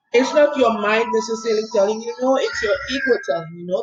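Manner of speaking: ordinary talk, nothing marked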